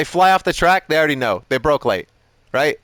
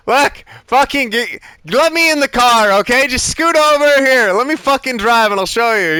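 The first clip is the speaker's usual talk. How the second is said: higher and louder, fed up and pushy; exasperated outburst; 7 semitones higher, 4 dB louder than usual. Retold or imitated. Coach to struggling student, demanding the wheel, exasperated and loud. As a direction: shove them aside with your voice, at full exasperation. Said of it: "I can't be like".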